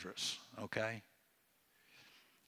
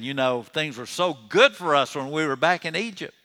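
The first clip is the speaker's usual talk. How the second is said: mock wistful griping, higher and louder; 4 semitones higher, 8 dB louder than usual. Preacher voices Israelites longing for Egypt, griping. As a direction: imitate grumblers pining loudly for the old days. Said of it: "they're griping".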